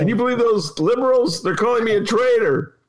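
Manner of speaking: high-pitched mock outrage; hammed-up indignant complaint; mocking Tim Scott. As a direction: act scandalized, voice pushed way up. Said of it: "Yeah, that's Tim Scott".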